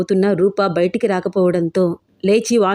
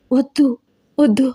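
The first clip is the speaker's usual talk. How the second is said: higher, fearful little plea; a touch of fear in a raised voice; frightened; 4 semitones higher than usual. A narrator's reading. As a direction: plead in mild fear, pitched up. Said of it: "అని భయపడుతూనే".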